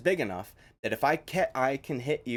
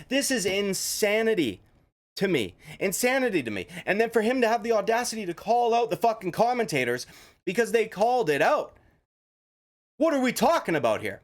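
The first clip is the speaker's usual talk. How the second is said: exasperated rant; 8 semitones higher than usual. Real, not acted. Ranting at Chandler's excuse.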